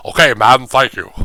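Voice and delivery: loud, stiff robotic co-host; mock robot host, punched loud. Shown as a demonstration. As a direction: say it like a flat machine. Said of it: "Mister fucking robot voice".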